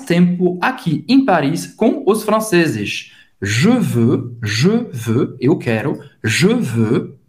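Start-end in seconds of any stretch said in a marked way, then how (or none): 3.4-5.4 JE VEUX with closed, rounded eu; closed French vowel. Shown as a demonstration. Teacher showing a student the vowel, closed JE VEUX. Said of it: "bem fechado, de novo esse som do E". 6.2-7.2 JE VEUX with closed, rounded eu; closed French vowel. Shown as a demonstration. Teacher showing a student the vowel, closed JE VEUX. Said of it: "bem fechado, de novo esse som do E".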